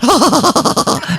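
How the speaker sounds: loud high imitated cackle; Roy Keane laugh. Comic laughs back at the footballer, loud mimicking cackle. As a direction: belt out a shrieking put-on laugh. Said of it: "sounded like each other laughing".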